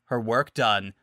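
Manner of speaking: plain reading voice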